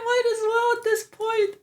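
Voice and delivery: breaking into giggles mid-line; laughing. Real, not acted. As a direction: laugh the words out helplessly. Laughing at own idea.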